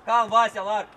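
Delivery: loud call to steady a player; coaching shout. Real, not acted. Coach telling Vasea to stay calm, loud shout. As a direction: shout the order across the pitch.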